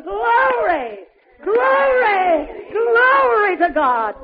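high, drawn-out shouts of praise; joyful shouting. Retold or imitated. Healed man shouts at every leap, joyful shouting. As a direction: cry it out afresh with each leap. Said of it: "at every leap shouting".

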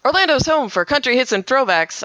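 high, projected station liner. Shown as a demonstration. DJ opening a break at an Orlando country station, projected. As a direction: sell the station slogan like a DJ. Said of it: "but it's much louder".

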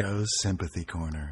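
low, soft announcer voice; soft show intro. Pretend. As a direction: introduce it gently and quietly. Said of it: "I'll edit in some soft, pretty music here".

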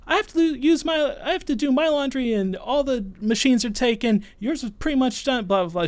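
high, loud, whiny mock yelling; mimicked yelling girl. Girl at laundry yelling at him, high mock whine. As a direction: imitate the girl snapping back defensively. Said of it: "She's, like, yelling at me like this".

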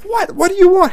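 dad jolted out of autopilot by his son; startled dad. Retold or imitated. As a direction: snap back in alarm. Startled dad answering screaming son.

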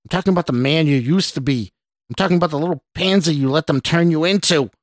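quick, scornful rant; snarling. A narrator's reading. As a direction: rattle off the insults with contempt. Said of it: "the stranger snarled".

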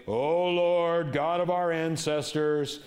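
booming, slow, over-formal prayer voice; hammed-up lofty prayer; mock grand prayer voice. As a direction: pray like a pompous preacher. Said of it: "drop down an octave".

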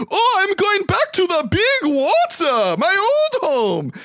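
very high, slow, drawn-out bird squawk; hammed-up bird crying out in a high put-on voice; bird character voice. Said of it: "The bird goes".

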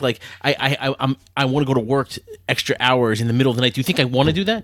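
normal talking voice

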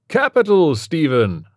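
high, loud gleeful praise; delighted doctor. A narrator's reading. Doctor gleefully congratulates Stephen on the result. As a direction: crow with delight. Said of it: "the doctor said".

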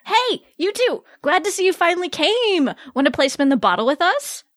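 put-on high, lively party-girl greeting; excited party friend. Kenzie welcomes two friends to party, high excited voice. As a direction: greet them in a high perky voice. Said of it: "Came the voice of Bo's best friend".

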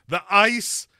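loud, high, dramatic movie-star impression; Christopher Walken. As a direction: blurt out the vision at full volume. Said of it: "like, Christopher Walken".

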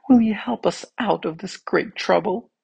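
high-pitched voice of the hands; pleading hands. A narrator's reading. Hands ask the tongue for help, pleading.

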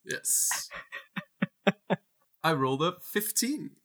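ordinary talk

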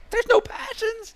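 high, loud dismayed fan cry; upset fan wail. Retold or imitated. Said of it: "they were all like".

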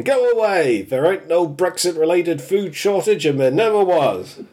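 high, pushy "go away" brush-off; mock official brush-off; insistent denial. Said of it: "B B C News going".

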